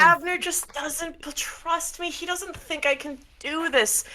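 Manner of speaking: high, aggrieved whine; unhinged Miracle figure, hurt. Acted.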